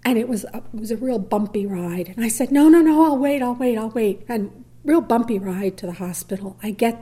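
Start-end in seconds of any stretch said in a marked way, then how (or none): 2.5-4.2 high, loud panicked no, fading to pleading; panicked refusal. Retold or imitated. Wife refusing to deliver in the ambulance, panicked then fading. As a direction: beg to wait.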